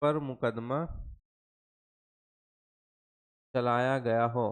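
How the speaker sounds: low-pitched dictation broken by long pauses; halting dictation. Real, not acted. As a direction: dictate piece by piece, low and subdued. Dictating in pieces while writing.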